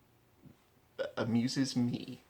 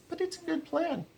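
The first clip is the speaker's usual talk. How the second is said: much higher, squeaky little schemer; squeaky minion voice. Pretend. Mocking his own plan, a squeaky minion voice. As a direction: pipe it up like a tiny scheming henchman.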